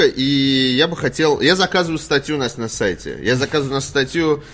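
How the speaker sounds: ordinary talk, nothing marked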